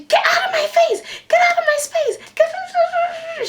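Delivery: high, frantic mock screech; imitated screaming. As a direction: screech it over and over, mimicking a meltdown. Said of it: "she was just screaming".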